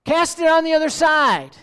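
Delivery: high, loud call across the water; a voiced Jesus calling from shore. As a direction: call out the command to the boat. Jesus voiced in Gospel story.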